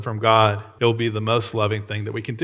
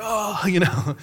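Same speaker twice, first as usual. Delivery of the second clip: imitated terrified child; 8 semitones higher, slower than usual. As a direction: mimic a startled little kid. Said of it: "like, terrified".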